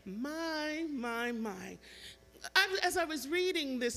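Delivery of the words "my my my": drawn-out "My", stepping down; musing, long first "My" then falling; imitated wordless wonder; lost for words. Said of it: "don't really have the words to say".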